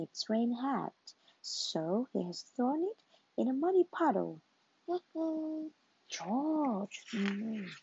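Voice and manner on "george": ordinary delivery, nothing marked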